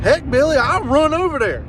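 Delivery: retelling his own excited offer. Retold or imitated. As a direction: jump in keen to go. Eager retold offer to run over.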